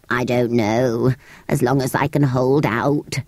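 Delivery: low, slow creature voice; weary creature. Acted. Creature doubting its strength, low and weary. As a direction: answer low and dragging.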